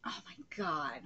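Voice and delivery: breathless unvoiced whisper. Real, not acted. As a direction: whisper it in shock. Stunned aside.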